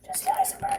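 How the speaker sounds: barely-there whisper as the actor; hushed, breath-only celebrity impression. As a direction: whisper the name in character. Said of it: "you gotta whisper".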